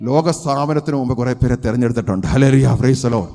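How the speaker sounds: loud, rising sermon climax that keeps climbing; full-force preaching. Acted. Preacher to his congregation on the chosen sheep, shouting in fervour. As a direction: proclaim it to the whole hall at the top of your voice.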